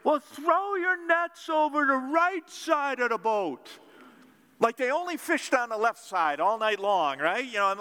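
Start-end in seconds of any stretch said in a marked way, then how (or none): 0.0-3.7 high, bright-eyed insistence; relentless stranger. Retold or imitated. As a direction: keep pushing, cheerfully relentless. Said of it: "He's like".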